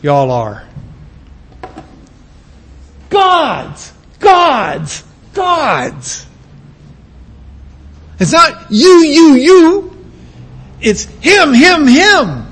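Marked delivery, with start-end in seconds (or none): 3.1-6.3 "God's" hammered three times, high; repeated emphatic God's. Shown as a demonstration. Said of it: "Paul knew where to place his emphasis". 8.2-12.5 "you" and "him" punched, higher; emphatic contrast. Shown as a demonstration. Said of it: "Paul knew where to place his emphasis".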